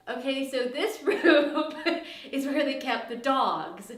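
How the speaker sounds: loud, laughing through the words. Real, not acted.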